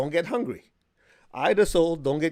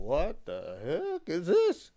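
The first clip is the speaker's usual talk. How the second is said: baffled; 4 semitones higher, much slower than usual. Retold or imitated. Baffled student walking into class.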